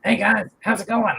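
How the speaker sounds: a hint of a chirpy little figure; cheery gnome voice. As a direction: greet the other gnomes brightly. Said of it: "you got the voices really good".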